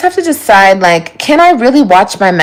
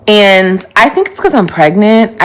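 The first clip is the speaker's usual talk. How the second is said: ordinary delivery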